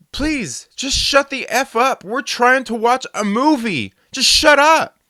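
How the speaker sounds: loud, high, slow shout to shut up; shouted demand. Retold or imitated. Telling the man to shut up at a loud shout. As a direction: yell it at him. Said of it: "I just said".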